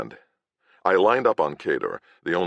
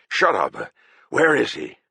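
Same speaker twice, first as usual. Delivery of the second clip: angry snap; 6 semitones higher than usual. Acted. Agravain snaps angrily.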